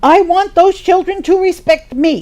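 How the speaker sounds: put-on the lonely friend about her children, bitter and loud; resentful demand. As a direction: demand respect like a hurt mother.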